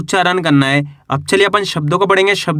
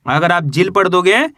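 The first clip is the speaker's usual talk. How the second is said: usual speaking voice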